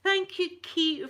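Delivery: delighted thanks. Real, not acted. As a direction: beam the thanks at her. Thanks the viewer by name.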